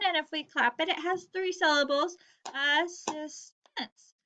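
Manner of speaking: assistance slowed into three clapped beats; clapping syllables. Shown as a demonstration. Teacher claps assistance for young students. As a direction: clap out assistance, as-sis-tance.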